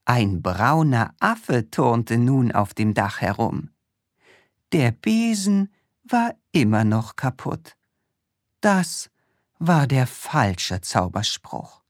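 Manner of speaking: ordinary delivery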